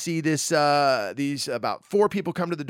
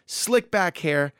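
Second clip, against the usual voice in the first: normal talking voice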